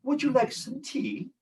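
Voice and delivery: high, soft British tea offer; British accent. Acted. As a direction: offer it in a polite put-on British voice. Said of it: "Mister Brown said to Paddington".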